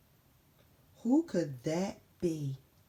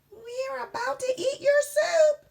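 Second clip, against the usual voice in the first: high cat voice; 7 dB louder than usual. Acted. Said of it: "added Dakota".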